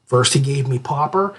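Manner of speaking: ordinary delivery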